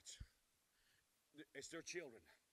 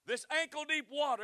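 call-out; 10 semitones higher, 5 dB louder than usual. Shown as a demonstration. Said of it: "everybody shout".

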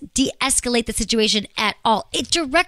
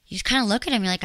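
normal talking voice

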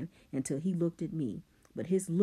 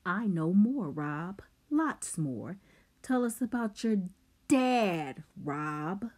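furious; 4 semitones higher, 3 dB louder, slower than usual. Acted. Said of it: "He was furious".

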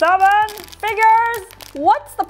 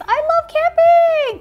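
excited; 6 dB louder than usual. Real, not acted. Excited toy unboxing exclamation.

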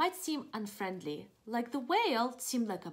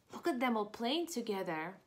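ordinary delivery

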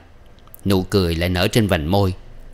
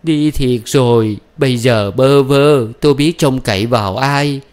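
tearful wailing; 5 semitones higher, 5 dB louder than usual. A narrator's reading. Old woman lamenting to absent Năm, tearful and wailing. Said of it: "Bà cụ mếu máu".

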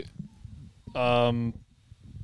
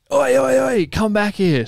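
shop lady calling out; 9 semitones higher, slower than usual. Retold or imitated. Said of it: "and the lady goes".

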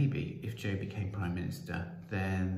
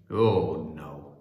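dismissive groan; 4 semitones lower, 8 dB louder than usual. Acted. Percival refuses to meet the children.

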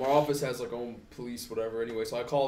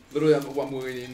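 usual speaking voice

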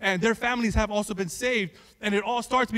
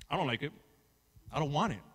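low, soft, resigned admission; subdued reluctance; 7 semitones lower, 9 dB quieter, much faster than usual. Acted. Preacher admitting to church he dislikes suffering, subdued reluctance. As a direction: drop down low and quiet.

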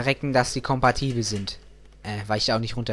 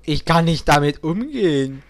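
higher, fast hammed-up despair; mock despair; 4 semitones higher than usual. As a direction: wail it in mock desperation. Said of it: "jetzt ist mal die totale Verzweiflung".

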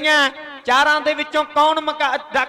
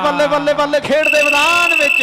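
excited cheering; faster than usual. Real, not acted. Cheering a point.

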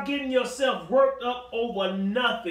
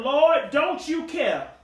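indignant plea; 6 semitones higher, 8 dB louder, much slower than usual. Acted. Preacher voicing Martha's complaint.

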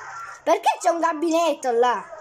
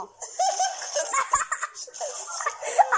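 high, breaking giggle; panicky laughter; 7 semitones higher, 6 dB quieter than usual. Real, not acted. Gamer overrun by zombies, panicky laughter. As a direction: laugh helplessly through the panic.

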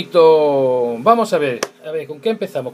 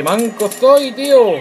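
loud, frustrated outburst at himself; raging gamer; 8 semitones higher, 6 dB louder, slower than usual. Real, not acted. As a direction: yell in frustration at missing. Misses shots, curses himself. (jugador enfadado gritando)

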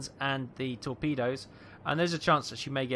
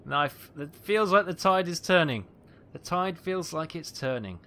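high, loud burst of elation; real excitement; 6 semitones higher, 6 dB louder, slower than usual. Real, not acted. Gamer feeling the tide is turning, excited. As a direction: cheer the hits, voice raised and lifted. Cheering hits as tide turns.

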